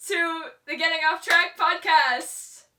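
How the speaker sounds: loud, high, drawn-out show welcome; projected coach voice. Real, not acted. Host welcoming listeners back to the podcast, projected coach voice. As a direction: project a big podcast intro. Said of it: "are we projecting our voices this time?".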